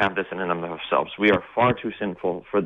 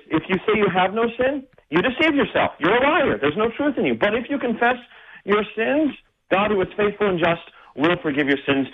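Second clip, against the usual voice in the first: higher, full pulpit preaching voice; 9 semitones higher than usual. Acted. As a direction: proclaim it like a Sunday sermon. Pastor shifts into preaching.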